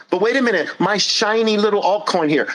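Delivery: high, pleading whine; mock protest, high and nasal; whiny excuse. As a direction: whine like a stalling child. Whiny objector.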